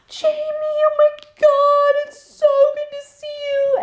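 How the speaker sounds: very high, slow, sing-song gushing woman; gushing stepmom. Retold or imitated. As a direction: overdo the delighted blonde stepmother. Stepmother greets Jamie.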